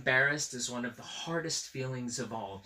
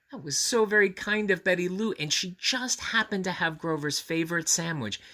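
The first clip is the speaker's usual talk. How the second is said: ordinary delivery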